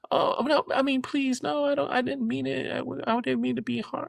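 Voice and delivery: hammed-up high-pitched begging to the officer; mock begging. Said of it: "she just was like".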